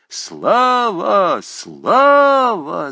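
loud, high chanting cry; crowd cheer. A narrator's reading. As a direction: roar it like a whole hall. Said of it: "закричала толпа". (громкий крик толпы)

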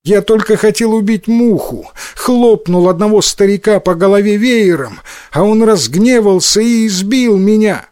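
put-on high-pitched child's voice, aggrieved; complaining little boy. A narrator's reading. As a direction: explain yourself like a wronged kid. Boy explains his beating to mother.

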